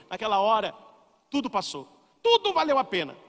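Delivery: ordinary talk, nothing marked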